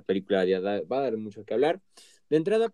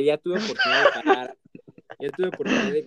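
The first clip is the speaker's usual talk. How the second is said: laughing while talking; 8 semitones higher, 6 dB louder, faster than usual. Real, not acted. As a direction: let a laugh run through it. Laughing aside.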